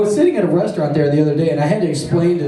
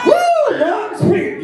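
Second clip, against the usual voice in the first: high whooping cheer; mock party whoop; slower than usual. Comic hyping Rumspringa to the crowd, high whooping cheer. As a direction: whoop it like a drunk partier.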